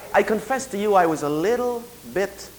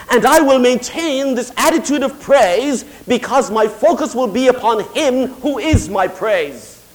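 raised, emphatic pulpit delivery; impassioned preaching; 6 semitones higher, 8 dB louder than usual. Real, not acted. Preacher to congregation on praise without reason, impassioned. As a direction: preach it out with stretched key words.

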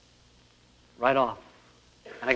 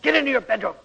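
angry order; 7 semitones higher than usual. Retold or imitated. Father ordering son away.

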